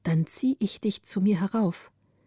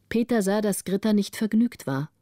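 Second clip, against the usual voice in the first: ordinary delivery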